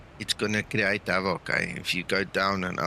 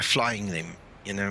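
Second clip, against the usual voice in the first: usual speaking voice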